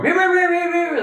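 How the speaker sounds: high, loud meowing whine; imitated whining. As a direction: mew like a begging animal. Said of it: "like that".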